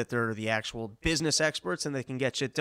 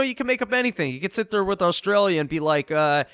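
ordinary talk, nothing marked

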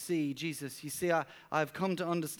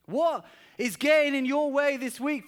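high, loud, impassioned preaching; preaching at full force; 8 semitones higher, 5 dB louder than usual. Acted. Pastor urging the church not to miss Jesus, full-force preaching. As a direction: drive the point home to the congregation.

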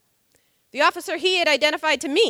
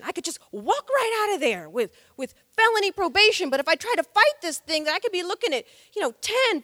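higher, pleading insistence, growing more desperate; agitated pleading; 6 semitones higher, 3 dB louder than usual. Retold or imitated. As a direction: retell a man protesting his innocence, higher and more urgent. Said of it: "he becomes more and more agitated".

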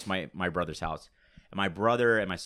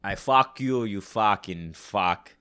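Boston accent with Arnold Schwarzenegger edge, loud; Boston tough guy crossed with Arnold; 6 dB louder, much slower than usual. Shown as a demonstration. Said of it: "almost a mixture between Boston and Arnold".

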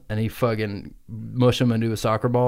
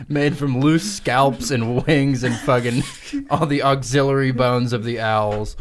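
ordinary talk

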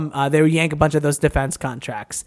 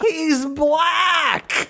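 mock hesitant voice; 11 semitones higher than usual. Repeats the mock line louder.

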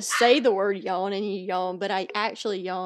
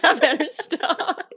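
slow, high words through laughter; laughing; 6 semitones higher, 5 dB louder, slower than usual. Real, not acted. Joking about yawn baiting, laughing helplessly. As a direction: try to stop while still laughing. Breaking up over her yawn prank.

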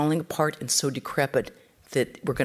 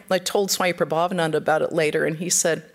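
ordinary talk

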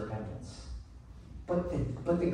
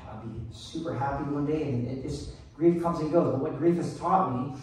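normal talking voice

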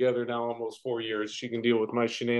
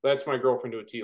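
ordinary talk, nothing marked

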